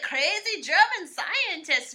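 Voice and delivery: loud, slow, high mock German accent; bad German accent. Podcaster mocking the movie's crazy scientist, in a bad German accent. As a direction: ham up a terrible German accent, slowly. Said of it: "with an accent as bad as my German accent".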